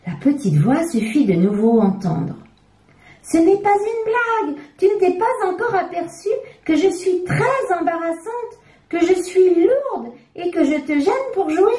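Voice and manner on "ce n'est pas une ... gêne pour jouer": much higher, whiny talking diaper; complaining diaper. A narrator's reading. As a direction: nag the child plaintively.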